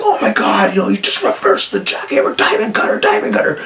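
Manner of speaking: high, breathless gushing; half-whispered fan squeal at the big move; losing it over the reversal. Retold or imitated. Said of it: "and I lost it too".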